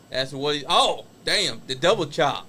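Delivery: excited live wrestling play-by-play; wrestling commentator. Acted. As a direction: shout the big moves, call the rest. Calls the wrestling match live.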